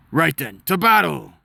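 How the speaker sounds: mercenary rallying his men; rallying cry. Acted. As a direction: rouse the troops to fight.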